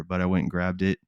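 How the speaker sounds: ordinary talk, nothing marked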